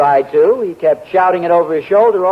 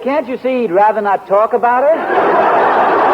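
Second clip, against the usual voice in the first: ordinary talk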